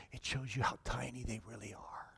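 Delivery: soft whispered conclusion; preacher whispering the moral; whispered point. Real, not acted. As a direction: drop to a whisper to land it.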